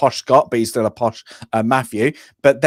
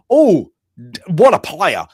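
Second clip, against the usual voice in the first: mock outrage; 2 semitones higher, 4 dB louder than usual. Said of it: "she said, if you flipped it".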